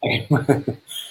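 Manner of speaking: quiet giggle; kind gentle laugh. Real, not acted. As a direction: chuckle softly at a friend. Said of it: "I'm laughing be-, at Joanne".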